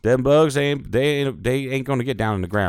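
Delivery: amateur southern accent, hammed up; southern drawl. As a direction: drawl it like a folksy southern gentleman. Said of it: "I can't even do the southern drawl very well".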